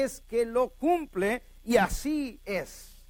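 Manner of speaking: high-pitched, measured proclamation; emphatic preaching. Real, not acted. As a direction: drive each assurance home to the congregation. Preaches at full force.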